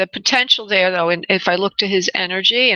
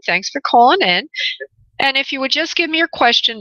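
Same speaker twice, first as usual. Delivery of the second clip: ordinary talk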